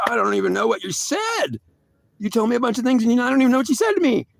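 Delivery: high, rapid, flustered complaint; agitated protest. Retold or imitated. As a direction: pitch it way up and rush the protest. Acted protest at God.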